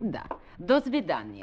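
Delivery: put-on Russian accent, lightly done; a woman posing as Russian, accent thin; Russian accent with a touch of flirt; fake Russian accent. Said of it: "What happened to your Russian accent".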